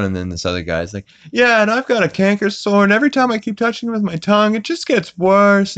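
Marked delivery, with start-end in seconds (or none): 1.3-5.8 high, put-on whiny voice; whiny townsman. Townsman whining to Peter about his canker sore.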